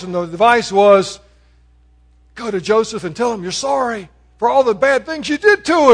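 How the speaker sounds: loud, driving sermon delivery; preaching. Acted. Preacher retelling the brothers' letter to the congregation, loud and driving. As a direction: drive the story home, loud.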